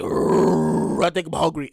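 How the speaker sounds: low growl then a hungry murmur; stomach growling at its owner, low and rumbling. Pretend. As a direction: groan like an empty belly. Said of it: "your stomach gonna be like".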